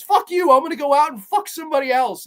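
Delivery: high, loud retold row; hammed-up lovers' spat; mock angry outburst. Woman threatens to cheat on her boyfriend, mock-furious and loud. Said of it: "where it escalates where she says".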